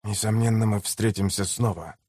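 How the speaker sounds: low, soft veiled threat; pointed, meaningful. A narrator's reading. Slave trader's parting words to the girl, quietly meaningful. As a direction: drop it quietly, heavy with meaning. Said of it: "многозначительно бросил он девочке".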